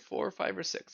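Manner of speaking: normal talking voice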